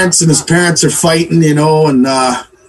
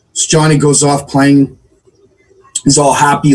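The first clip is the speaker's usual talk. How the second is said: ordinary delivery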